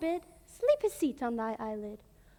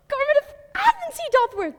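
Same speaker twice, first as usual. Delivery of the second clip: excited discovery; 10 semitones higher, 5 dB louder than usual. Acted. Puck discovers sleeping Athenian.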